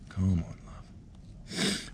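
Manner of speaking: very slow, tender whispered coaxing. Real, not acted. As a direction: draw the word out gently, like luring a shy pet. Coaxing spider out of hole.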